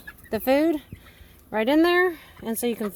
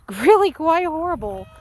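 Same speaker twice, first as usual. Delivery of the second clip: rueful laugh; 5 semitones higher, 6 dB louder than usual. Real, not acted. Laughs at the drought.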